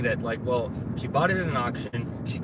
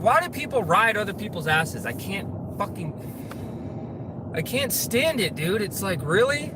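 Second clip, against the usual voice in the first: exasperated rant; 8 semitones higher than usual. Real, not acted. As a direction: vent your frustration at the road. Ranting about tailgaters.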